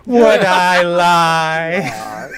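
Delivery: very slow, drawn-out mock innocence; Spaceballs king impression. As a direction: stretch it out like a hammy villain. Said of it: "the king in Spaceballs".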